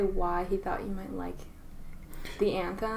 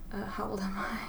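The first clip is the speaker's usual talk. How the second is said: whispering; 7 dB quieter than usual. Real, not acted. Asking himself his age aside.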